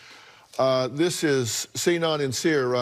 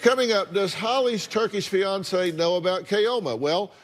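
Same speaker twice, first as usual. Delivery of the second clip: upbeat TV host tease, a touch higher; talk show tease; 5 semitones higher than usual. Acted. Host teases the next segment to viewers, upbeat announcer read. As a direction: hook the audience before the break.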